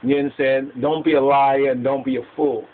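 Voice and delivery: usual speaking voice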